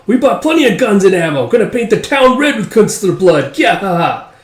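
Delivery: high, loud gleeful threat; wild high-pitched raider with a mock laugh; rowdy villain goon. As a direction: crow about the carnage to come. Blade bug gang threatens town.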